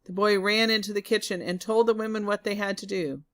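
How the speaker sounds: plain reading voice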